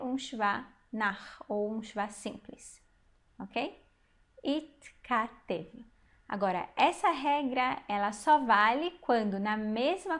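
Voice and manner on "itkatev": Itkatev slowly broken into it, ka, tev; slow reading demo. Shown as a demonstration. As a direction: sound out Itkatev one syllable at a time. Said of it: "como você acha que nós vamos ler".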